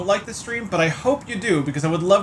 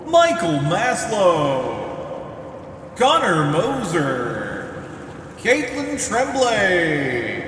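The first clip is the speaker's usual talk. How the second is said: wrestling announcer; much slower than usual. Acted. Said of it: "You will have to do them all in a wrestling announcer voice next time".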